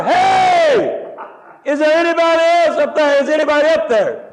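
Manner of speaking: high, long-held desperate holler; screamed cry for help. Retold or imitated. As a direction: scream it up the cliff so somebody hears. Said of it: "so he screamed louder".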